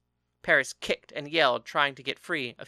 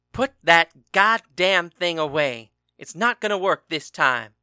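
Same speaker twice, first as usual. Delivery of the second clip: loud, slow furious shout; fuming; 3 semitones higher, 9 dB louder than usual. A narrator's reading. Angry husband shouting at husband playing harmonica, fuming. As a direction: blast him to stop playing. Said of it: "Eurystheus fumed".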